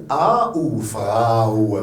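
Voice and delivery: slow "A ou Frau", A gliding into OU; both vowels sounded. Shown as a demonstration. Teacher asking learners to sound every letter, both vowels drawn out. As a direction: draw out "Frau" as FRA-OU. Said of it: "prononcez toutes les lettres".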